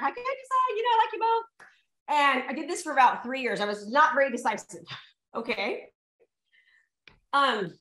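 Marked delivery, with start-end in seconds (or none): 0.0-1.7 put-on girlish indecision. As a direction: play your younger undecided self, lightly silly. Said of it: "I'm like".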